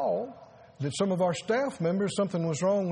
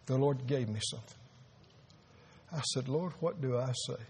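hushed grief; 6 semitones lower, 7 dB quieter than usual. Real, not acted. Grieving pastor before the funeral.

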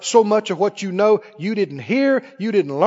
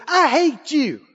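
lightly voiced angry child; small child's outburst; 5 semitones higher, slower than usual. Preacher acts out a young child's words, lightly voiced small child. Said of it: "if a baby, if a three-year-old".

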